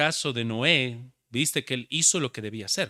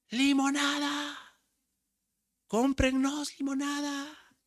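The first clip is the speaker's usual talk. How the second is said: put-on high, drawn-out little girl's street cry; child calling out; 12 semitones higher, slower than usual. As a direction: call it out like a small girl selling lemonade. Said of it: "empezaba a gritar más fuerte para provocarle". (niña pregonando limonada)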